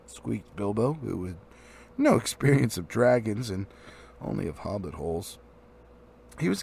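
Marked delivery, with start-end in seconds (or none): none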